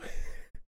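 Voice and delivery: soft, breathy laugh; real stifled laugh. Real, not acted. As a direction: crack up quietly after your own bit. Cracks up after own skit.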